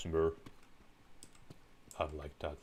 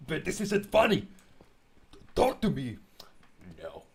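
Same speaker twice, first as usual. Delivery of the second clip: shrill high mock shriek at Ben, much louder; hammed-up screaming panic; 14 dB louder than usual. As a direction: scream it all out in fake terror.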